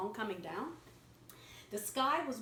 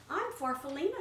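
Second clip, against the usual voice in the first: Farfalina's voice; 6 semitones higher, 4 dB louder than usual. Acted. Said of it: "she said".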